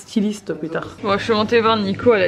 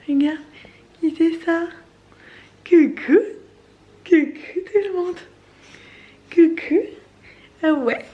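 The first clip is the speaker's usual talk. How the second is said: put-on baby voice; 4 semitones higher than usual. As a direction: talk for the baby as if it just woke up. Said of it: "Regardez qui vient de se réveiller".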